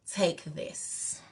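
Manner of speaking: hushed unvoiced whisper. Lady handing over a spiritual bath, whispered. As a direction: breathe it with no voice.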